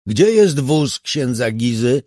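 high, loud, slow shouted question. A narrator's reading. Meyer shouts to the officers about the priest's car, loud. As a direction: call it out across the crime scene. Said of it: "krzyknął".

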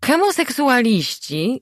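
high, slow Hemoseksualiści with hemo-; mother repeats the wrong Hemoseksualiści; mispronounced word. A narrator's reading. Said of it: "zaczęła ponownie". (wysokie, powolne Hemoseksualiści z e)

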